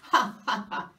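loud ha ha ha; mocking laugh. Narrator laughing at the mouse pretending to be a tiger.

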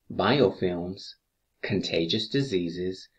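usual speaking voice

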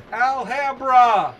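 high, loud mock-wailing flourish; hammed-up wail. Reader making fun of the story's saloon name, hammed-up wail.

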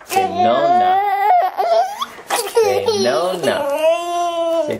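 high laughing prompt. Real, not acted. Mom coaxing Maximo to say nona, laughing.

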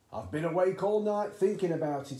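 high, loud, bursting with excitement; excited cry. A narrator's reading. Excited father bursting in at breakfast, loud and high. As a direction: blurt it out after a sleepless night of scheming. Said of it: "he cried".

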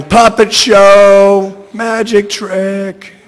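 high, long-drawn shout, fading at the end. A narrator's reading. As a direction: shout it high and slow, easing off on the last words.

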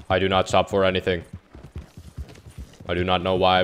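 flat monotone robot voice. Pretend. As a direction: speak like an emotionless robot, fast. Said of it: "I am using a monotone robot voice".

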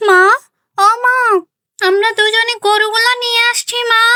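high eager call to mother; a girl calling home from the field; young daughter calling. Acted. Daughter bringing the cows home calls her mother, high and eager.

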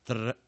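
嘚儿 as a rolled Spanish R; tongue-trill demo. Shown as a demonstration. Teacher illustrating the trill, rolled 嘚儿. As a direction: let the tongue tip vibrate on 嘚儿. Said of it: "它是个战舌音哈".

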